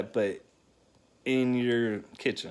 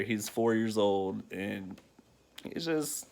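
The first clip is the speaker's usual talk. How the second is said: ordinary talk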